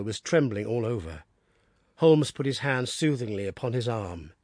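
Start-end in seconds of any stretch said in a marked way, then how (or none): none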